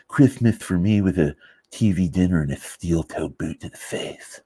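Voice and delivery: lisping movie-character impression; Cable Guy. Quoting a film to fans, lisping impression. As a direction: put on a slushy lisp, fading at the end. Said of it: "any of you are Cable Guy fans".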